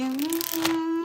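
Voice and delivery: high, loud sustained hum; drawn-out hum. Real, not acted. Reader hums while seeing what comes towards us, drawn-out hum. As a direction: hold a long high "mm".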